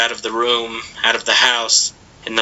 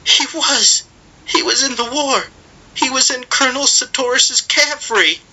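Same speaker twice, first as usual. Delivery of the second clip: put-on boy crying out softly; 14 semitones higher than usual. A narrator's reading. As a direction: say it aloud under breath with grief. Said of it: "aloud but not loud".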